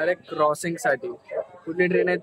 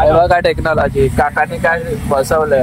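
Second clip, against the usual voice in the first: loud; 9 dB louder than usual. Real, not acted. Talking over rickshaw music.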